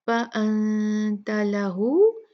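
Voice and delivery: लहू with the u held two counts; two-count stretch. Shown as a demonstration. Teacher showing madd silah on लहू, slow. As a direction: draw out the final u of लहू. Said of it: "दो count इसको खींचेंगे".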